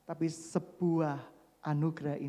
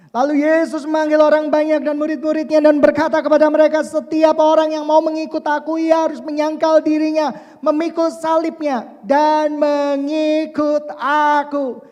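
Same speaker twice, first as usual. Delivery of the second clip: loud, high proclaiming, slowing at the end; preaching at full force; 11 semitones higher, 16 dB louder than usual. Acted. Preacher proclaiming the call to deny oneself, forcefully. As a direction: declare Jesus' call to follow him.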